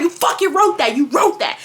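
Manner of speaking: lightly acted screaming mom; mock screaming. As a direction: imitate mom yelling, only half full force. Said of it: "she's screaming at me".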